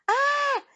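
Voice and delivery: short high scream; loud shriek of alarm. A narrator's reading. Julie watches Eric fall, high scream. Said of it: "Julie screamed".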